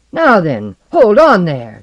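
loud, forceful bellow. A narrator's reading. Guard on the run hailing a staggering drunk, bellowed. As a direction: bellow an order to halt. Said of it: "she bellowed".

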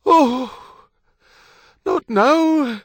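loud, very high wail; pained cry. A narrator's reading. Wife in sudden labour on a pitching barge, crying out. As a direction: cry out in dismay as pain hits. Woman hit by labour pains.

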